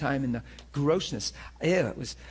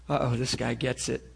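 ordinary talk, nothing marked

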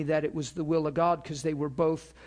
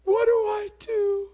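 much higher, slow tearful question; high, drawn-out weeping voice; crying plea; 5 dB louder, slower than usual. Retold or imitated. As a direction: imitate the sobbing caller asking for help. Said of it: "That's what they're saying".

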